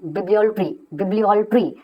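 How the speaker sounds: bibliolatry ending in a clear ट्री; bibliolatry said with ट्री, not लेटरी; pronunciation demo. Shown as a demonstration. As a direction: stress the ट्री at the end of bibliolatry. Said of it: "यहाँ पे इसको ट्री पढ़ेंगे आप".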